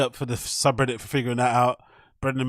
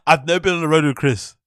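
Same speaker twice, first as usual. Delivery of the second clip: mimics the line while laughing, louder; laughing mock quote; 6 dB louder than usual. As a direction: parrot his excuse through laughter.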